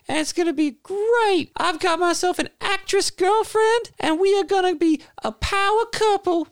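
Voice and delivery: very high, eager rock-singer voice; a falsetto wannabe rock star; lead singer voice. Acted. A lead singer pitching his band to the player, very high and eager. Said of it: "the lead singer of the band now interrupts".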